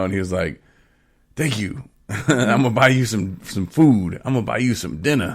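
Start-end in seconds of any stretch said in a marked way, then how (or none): 1.4-5.4 higher, eager thanks with a laugh in it; bubbling over with gratitude; excited. Retold or imitated. Tyrone thanking his friend on the phone, excitedly. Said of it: "he was all excited".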